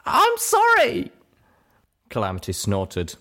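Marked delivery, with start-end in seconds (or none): 0.1-1.1 very high, loud, drawn-out cry; sobbing yell. A narrator's reading. Apologizing under Calamity's glare, a high sobbing wail. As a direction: wail it, breaking into sobs. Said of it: "as I broke into sobs".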